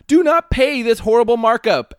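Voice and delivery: high, loud hammed-up rant; mock outrage. Reading angry review to co-hosts, hammed-up outrage. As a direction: yell it as an indignant customer.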